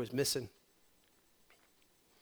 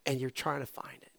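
whisper; 3 semitones lower, 8 dB quieter than usual. Real, not acted. Sermon drops to a whisper.

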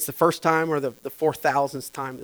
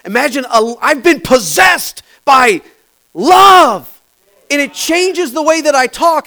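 much louder and higher, shouting; full-force preaching; 11 semitones higher, 15 dB louder than usual. Acted. Pastor preaching Holy Ghost power, shouting. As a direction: build to a roaring shout of joy.

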